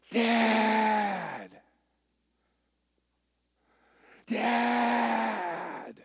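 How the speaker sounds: put-on a kid yelling for his father in protest; son's outcry. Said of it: "He goes".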